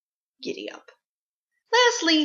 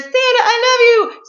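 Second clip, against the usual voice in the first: mock child voice; 12 semitones higher, 8 dB louder than usual. Child writing letters to Santa.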